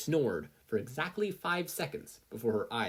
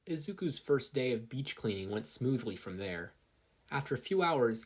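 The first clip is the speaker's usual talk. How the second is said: ordinary delivery, nothing marked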